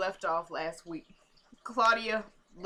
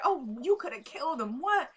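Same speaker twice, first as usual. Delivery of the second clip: mock concern rising to a high-pitched what; feigned concern; 4 semitones higher than usual. Retelling Natalie hearing about Eddie, mock concern. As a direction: imitate someone pretending to care. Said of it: "trying to act like she actually cared".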